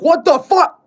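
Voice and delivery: high loud shocked yell; shocked outburst. Retold or imitated. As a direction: blurt it out stunned. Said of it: "have my shadow flash there being like".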